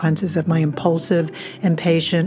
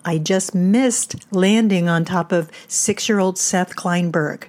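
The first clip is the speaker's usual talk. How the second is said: ordinary delivery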